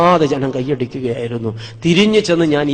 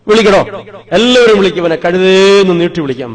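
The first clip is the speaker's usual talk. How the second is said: loud, high scornful teacher; retold teacher's loud mockery; mocking teacher; 5 semitones higher, 7 dB louder than usual. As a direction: jeer at the boy in front of the class. Teacher mocking failing pupil.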